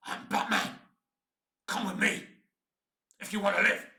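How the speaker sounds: hammed-up gravelly Batman growl. Hoping to land the role, a hammed-up Batman growl. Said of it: "Listen to my Batman gravelly voice".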